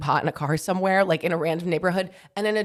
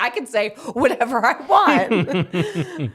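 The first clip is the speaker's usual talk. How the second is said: slow, louder, laughing swagger; mock tough talk; 5 semitones higher, 5 dB louder, slower than usual. Teasing husband about big cousins, laughing swagger. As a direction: puff up and laugh through it. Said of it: "You're like".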